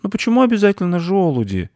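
put-on high-pitched kid's objection; protesting boy. Boy answers his friend about acorns, protesting.

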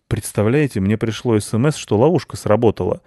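ordinary delivery, nothing marked

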